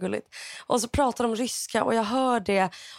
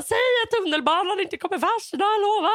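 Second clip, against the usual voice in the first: high, insistent child-like plea, retold; reenacted panicky begging; anxious pleading; 9 semitones higher than usual. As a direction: beg for a promise, pitched right up. Retells anxious plea to mother.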